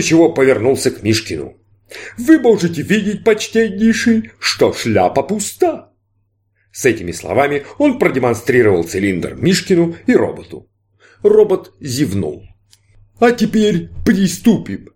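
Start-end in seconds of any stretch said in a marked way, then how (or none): 2.2-5.9 high, slow showman voice; grand stage magician, inviting. Acted. Magician addresses Mishkin. 13.2-14.9 high, slow showman voice; grand stage magician, inviting. Acted. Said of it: "сказал фокусник".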